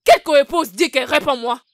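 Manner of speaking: loud indignant retort. Acted. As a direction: snap back, outraged at the word. Épouse jalouse s'emporte contre son mari. (réplique indignée et forte)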